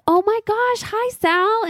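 high, gushing hello to a celebrity; excited fangirl greeting. Retold or imitated. Retelling her dream, greeting bartender Sal with excited delight. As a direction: squeal it like you just spotted your idol.

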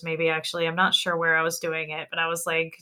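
ordinary delivery

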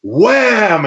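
high, loud "wham"; sound-effect shout. Retold or imitated. Storyteller showing the kick hit his chest, punchy exclamation. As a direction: punch it out like the blow landing. Imitating the kick's impact.

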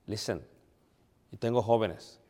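ordinary talk, nothing marked

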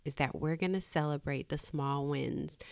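ordinary talk

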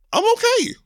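out of podcast voice. Real, not acted. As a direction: squeal it out, voice shooting way up. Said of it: "Oh, let me get my podcast voice".

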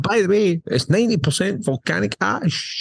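falsetto mock announcement; chirpy fake-friendly reveal; high mocking voice. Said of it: "they like".

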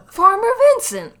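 proud announcing shout. Retold or imitated. Co-host joining in, shouting the farmer's name triumphantly. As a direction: bellow the name like a reveal.

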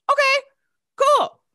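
two loud, very high squeaked words; retold thrilled fan. Fan invited to meet idol, thrilled squeak. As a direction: squeak each word separately, overwhelmed.

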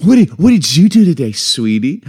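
loud, high, gushing grandma; hammed-up grandma voice. Shown as a demonstration. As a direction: coo it like a grandma. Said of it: "Like a grandma".

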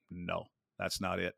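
low, soft, slow and matter-of-fact; calm flat verdict. Retold or imitated. Coach Bob judging a rookie's drawing, low calm verdict. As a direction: lightly imitate the coach's quiet calm verdict. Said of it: "he'd bring you in in a calm voice".

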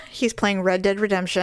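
usual speaking voice

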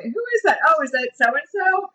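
squint and guess who it is, up high; puzzled high guess. Retold or imitated.

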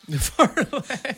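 high, slow mocking echo. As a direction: drag it out high and teasing. Mocking repeat of phrase.

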